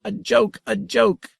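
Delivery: very high, delighted little voice; tiny giggling creature. A narrator's reading. The grandmother delights in the word joke, very high and gleeful. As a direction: squeak it out with glee.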